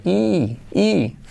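"i" alargada como saludo ridículo; saludo cursi burlón. Acted. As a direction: parodia un saludo cursi con una i larga. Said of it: "Qué cursi saludar a alguien". (saludo cursi de mentira, estirado)

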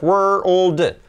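WORLD split as wer-ull-d; slow, segmented WORLD; three sounds shown. Shown as a demonstration. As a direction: separate the R, L and D in WORLD. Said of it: "qué suenan los tres sonidos".

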